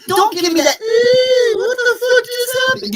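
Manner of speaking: high squeaky mock whine; mocking the player. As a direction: mimic him like a clueless whiner. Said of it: "mocking the player's reaction".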